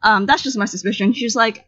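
normal talking voice

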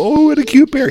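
high, loud mock baby-talk cooing; cooing at bear. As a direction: gush over a cute animal in a sing-song squeal. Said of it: "try to tickle it under its chin and go".

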